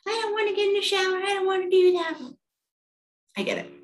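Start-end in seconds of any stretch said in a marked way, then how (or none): 0.1-2.2 put-on high-pitched whiny child protest; mock kid whine in a high voice; six-year-old daughter. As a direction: imitate a little kid refusing, high and fast. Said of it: "she was like".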